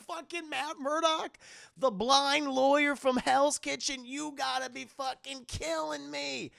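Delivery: high, slow mocking villain voice; laughing villain. Kingpin unmasks the hero and mocks him, high and slow. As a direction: sneer it out high and slow, amused. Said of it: "he starts fucking laughing".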